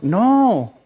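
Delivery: ordinary delivery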